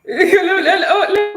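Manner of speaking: high-pitched giggle breaking the words; laughing. Real, not acted. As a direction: crack up mid-attempt. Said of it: "laughing at failed accent".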